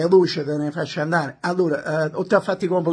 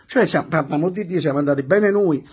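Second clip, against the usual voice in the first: ordinary delivery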